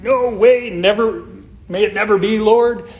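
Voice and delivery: acted-out outburst, high and loud; vehement protest. Preacher voicing Peter's refusal to the Lord, vehement protest. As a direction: blurt it out as an impetuous disciple objecting.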